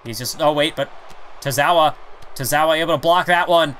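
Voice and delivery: usual speaking voice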